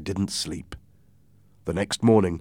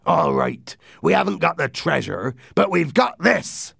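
raised, emphatic pirate voice; pirate captain; 9 semitones higher, 4 dB louder than usual. Acted. Silver revealing the map to his men, emphatic.

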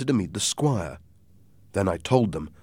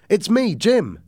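high, loud call across the dark; 13 semitones higher, 7 dB louder, slower than usual. A narrator's reading. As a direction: call out to the captain from outside. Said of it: "I called".

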